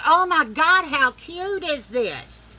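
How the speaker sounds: high, loud squeal, then slow and drawn out; delighted gush. Retold or imitated. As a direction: gush over the cute outfit. Mama delighted by outfit.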